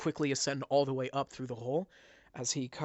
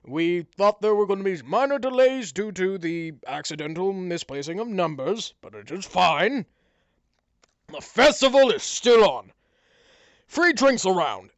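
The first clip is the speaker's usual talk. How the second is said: higher, louder hearty voice; pompous mayor; 5 semitones higher, 12 dB louder than usual. Acted. Mayor addressing the cheering tavern, pompous and loud. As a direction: play the self-important mayor. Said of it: "And he's like".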